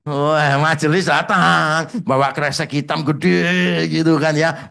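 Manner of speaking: normal talking voice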